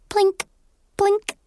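two high, separate little blinks. A narrator's reading. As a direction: say each blink high and crisp, pausing between. Sound word set apart in narration.

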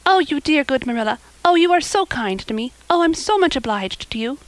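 high, overjoyed thanks; rapturous grateful girl; gushing gratitude. Acted. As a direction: pour out thanks in delighted bursts. Anne thanks Marilla rapturously.

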